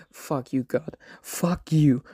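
usual speaking voice

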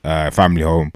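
ordinary delivery

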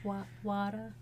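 New Jersey accent, water said wooder. Shown as a demonstration. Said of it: "My Jersey accent".

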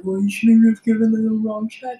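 imitated dad's telling-off, altered voice; scolding Korean dad. Boss reproaching waitress over the wrong check, stern dad. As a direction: scold like a disapproving Korean father. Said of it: "go all, like, Korean dad on me".

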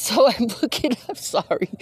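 laughing. Real, not acted. Laughs at squirrel distraction.

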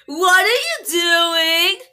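slow, high exasperated wail; wailing question. Pretend. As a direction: draw it out high in mock despair. Third, wailing repeat.

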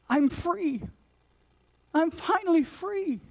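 high, slow, awed relief; wondering joy. Retold or imitated. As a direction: breathe it out, newly free. Paula freed after praying.

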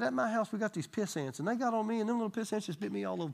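put-on high, bright-eyed little girl voice; voicing a little girl's complaint. Pastor retells a little girl complaining about ants. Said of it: "And she said".

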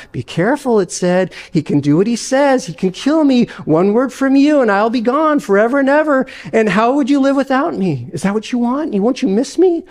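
a pet begging not to be killed; wheedling lizard. Acted.

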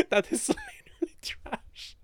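breaking up into high, whispery laughter; helpless wheezy giggle while talking; real laughing. Real, not acted. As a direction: squeeze the words out through laughter. Cracks up at own remark.

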